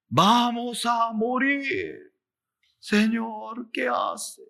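high, drawn-out mock cry of fear; acted alarm. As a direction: plead in pretend panic, high and stretched. Voices disciples caught in the storm.

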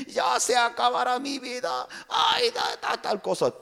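high, pleading voice of a troubled believer; distressed member calling the pastor; anxious church member. Acted. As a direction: beg the pastor for help, high and fretful. Troubled member calls pastor, acted.

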